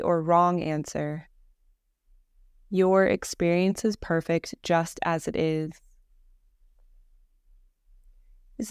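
calm, even meditation voice with long pauses; guided meditation. Acted. Leads a Yoga Nidra meditation.

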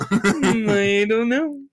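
slow lightly voiced cartoon bear; a hint of Yogi Bear, drawn out. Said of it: "pretty good Yogi Bear impression".

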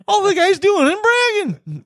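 very high, exasperated outburst; high-pitched indignant yelp; mock outrage. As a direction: squeal it in hammed-up disbelief.

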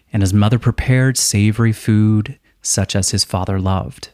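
usual speaking voice

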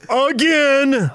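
high, drawn-out mock yell; yelling all caps. Host reading a listener's all-caps complaint, mock yelling. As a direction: belt it out like an all-caps message. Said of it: "I had to yell. That's why I had to yell".

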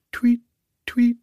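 high chirping tweet; bird chirp. A narrator's reading.

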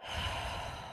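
soft, drawn-out audible sigh; calming exhale. Shown as a demonstration. Nurse showing how to stop rattling, calming exhale. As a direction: let the breath out to settle down. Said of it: "So then you have to, like".

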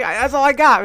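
loud, high comic complaint; acted-out indignant protest; exasperated protest. Retold protest after one more time.

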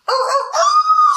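high, loud imitated wolf howl. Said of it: "and she goes".

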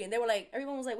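ordinary talk

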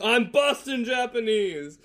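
slow, loud, high heroic self-introduction; superhero announcement. Pretend. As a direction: announce yourself like a cheesy superhero. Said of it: "He just comes out and is like".

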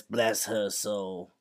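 slow, softened quote in character; lightly acted, drawn-out blessing; quoted character. Co-host quoting a character from the show, slow put-on voice. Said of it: "and he says".

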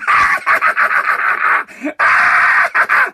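loud, high cackling burst, drawn out; maniacal laugh. Retold or imitated. Imitating the coworker pulling him under, maniacal laugh. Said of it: "and he's going".

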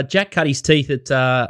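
normal talking voice

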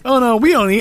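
high, prim little protest; gently offended Brit. Retold or imitated. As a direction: sound politely scandalised. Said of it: "It's like".

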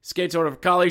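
normal talking voice

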